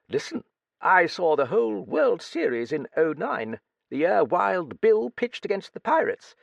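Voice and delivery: put-on high, crusty old British lady voice; old Duchess. A narrator's reading. Old Duchess quizzing the young writer, high crusty voice. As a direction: play a brusque, nosy old dowager.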